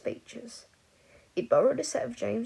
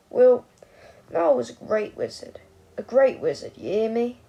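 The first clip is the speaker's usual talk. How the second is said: put-on drunken slur; 9 dB louder than usual. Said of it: "he slurred".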